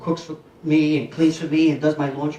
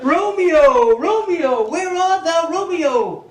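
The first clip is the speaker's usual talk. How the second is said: higher, theatrical swooning call; mock Juliet; 8 semitones higher, 3 dB louder, much slower than usual. Quoting Juliet from Shakespeare class, higher swooning theatrical call. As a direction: ham up a lovestruck Juliet. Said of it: "what about this one too".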